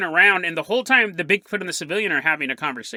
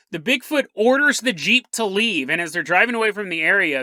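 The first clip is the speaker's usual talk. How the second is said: usual speaking voice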